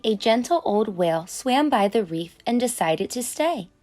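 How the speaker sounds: plain reading voice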